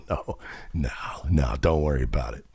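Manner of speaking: breathy hushed no, don't worry; whispered no. Retold or imitated. Waving off the new vet's offer, in a whisper. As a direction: whisper it like a secret brush-off. Declining records help, hushed aside.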